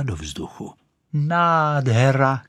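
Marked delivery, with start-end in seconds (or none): 1.1-2.4 high, drawn-out cry of bliss; slow exultant exclamation; shouting. A narrator's reading. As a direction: stretch it out in rapture. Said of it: "křičela".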